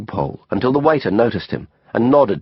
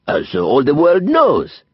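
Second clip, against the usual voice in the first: put-on slow, higher French-accented pronouncement; Didier, self-satisfied Frenchman; 6 semitones higher, 3 dB louder, slower than usual. A narrator's reading. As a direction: add it grandly as a Frenchman. Voices Didier adding grandly.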